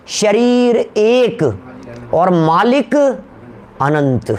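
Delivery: each word stretched out long with a pause between halves; slow, emphatic definition. Real, not acted. Teacher defines common plant life for class, slow and emphatic. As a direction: drum the definition in very slowly.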